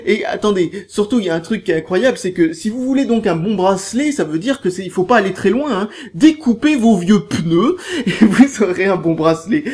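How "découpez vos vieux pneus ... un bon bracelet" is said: laughing through the words; en riant. Real, not acted. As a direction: chuckle while giving the advice. Joke about cutting old tyres. (en riant à moitié)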